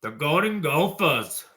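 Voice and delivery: hammed-up northern US accent, swooping pitch; badly faked Minnesota-style northern accent. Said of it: "That's my northern accent".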